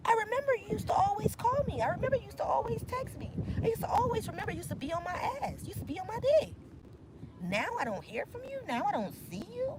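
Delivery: high, fast whiny ex; mock missing ex. Ex wonders where the reader went, high and whiny. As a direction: whine like the abandoned one. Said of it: "trying to figure out".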